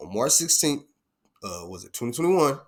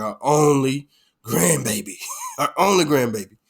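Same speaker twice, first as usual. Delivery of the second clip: sweet grandma voice; 2 semitones higher, 7 dB louder than usual. Retold or imitated. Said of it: "my mom was so sweet".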